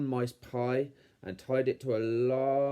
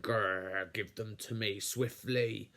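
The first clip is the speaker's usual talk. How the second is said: growling villain uncle; 4 dB quieter than usual. A narrator's reading. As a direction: growl it with greedy menace. Greedy uncle growls a demand.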